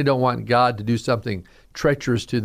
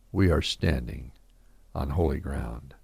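much lower and softer, a hushed echo of the hymn; spoken softly; 12 semitones lower, 7 dB quieter than usual. Real, not acted. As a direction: whisper the hymn words back as a quiet truth.

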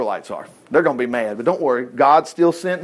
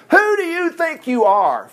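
acted royal indignation, pitched way up; indignant mock shout; 11 semitones higher, slower than usual. A narrator's reading. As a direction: challenge them like an offended king. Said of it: "Pharaoh shouted".

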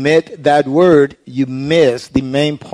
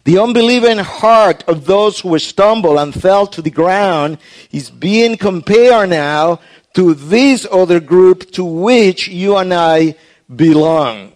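ordinary talk, nothing marked

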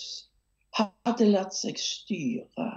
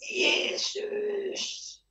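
"Jesus!" cried out much higher and drawn out; forceful cry; 12 semitones higher, 4 dB quieter than usual. Acted. Blind beggar crying out to Jesus over the crowd, high-pitched forceful cry. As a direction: call out to Jesus with full force. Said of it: "Og så kom det med kraft".

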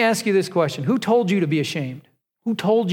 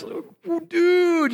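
high, drawn-out awestruck "dude"; acting out a thrilled, stoked guy; stoked Adam; 12 semitones higher than usual. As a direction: gush in amazement, voice way up high. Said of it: "so stoked".

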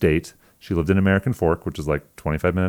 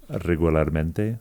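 slow Spanish "Regularmente", rolled r; Spanish pronunciation; 3 semitones lower, 5 dB quieter, slower than usual. Shown as a demonstration. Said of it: "Say it in Spanish".

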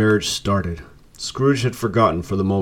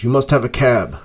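usual speaking voice

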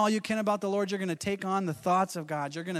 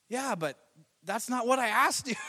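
higher, put-out complaint; exasperated Moses; 6 semitones higher than usual. Retold or imitated. Moses complaining to God, exasperated. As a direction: whine that God dodged the question. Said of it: "imagine Moses".

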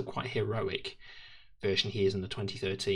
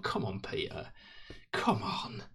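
whispered plea; 3 dB quieter, slower than usual. Real, not acted. Pleading with Peter Capaldi.

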